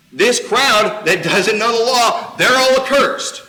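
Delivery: slow, loud, high sneer; sneering Pharisee. Acted. Pharisees sneer at the crowd, loud and mocking. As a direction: spit out the contempt for the crowd.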